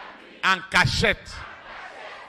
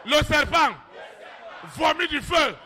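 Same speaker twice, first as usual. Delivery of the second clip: full-force preaching; 7 semitones higher than usual. Acted. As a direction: thunder against the devil.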